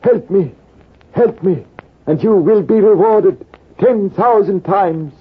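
high, pleading cry for help; pleading shout. Acted. Buried Persian crying out to the passing soldier, high plea. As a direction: beg to be dug out. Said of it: "and he shouted".

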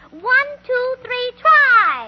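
high count building to the cue. Acted. Hostess starts the women on the line, high counting in. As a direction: cue everyone in on the last word.

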